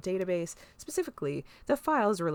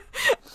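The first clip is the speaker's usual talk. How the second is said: high burst of laughter; squealing laugh; 9 dB louder than usual. Real, not acted. To friends, cracking up with a high squeal.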